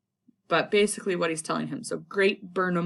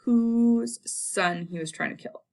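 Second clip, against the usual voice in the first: ordinary talk, nothing marked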